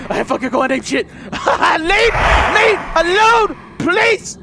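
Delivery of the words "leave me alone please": high, slow drawn-out begging; terrified player begging the boogeyman; desperate pleading. Real, not acted. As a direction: plead with the monster to go away. Begging the game monster.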